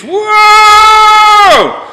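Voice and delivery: long, very high, loud whooping whoa; wild whoa yell. Retold or imitated. Irish charging the bikies with a loud high whoooa. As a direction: let out a huge drawn-out whoa.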